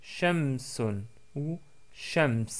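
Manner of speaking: shamsun and shams read out very slowly, syllable by syllable; slow model word. Shown as a demonstration. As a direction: slowly sound out shamsun, then shams, for learners. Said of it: "comme dans le mot shamsun".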